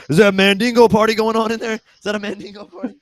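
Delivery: high, fast, loud eager question; excited priest quote. Retold or imitated. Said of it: "He's like".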